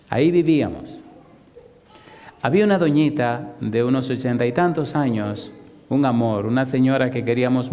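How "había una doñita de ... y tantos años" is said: ordinary delivery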